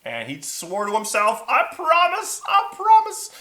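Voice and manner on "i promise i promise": very high, loud squeal; high-pitched emphatic promise; earnest dragon vow. Acted. Dragon swears to himself.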